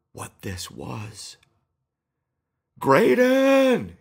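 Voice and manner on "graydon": full-throated shout across the swamp; panicked shout for a friend. Acted. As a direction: yell the name as loud as you can. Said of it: "he shouted".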